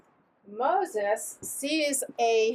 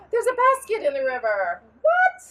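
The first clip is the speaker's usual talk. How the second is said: amazed cry leaping high on WHAT; hammed-up disbelief, pitched up; acted astonishment; 10 semitones higher, 7 dB louder than usual. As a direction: play the startled discovery for children. Acts princess finding the basket.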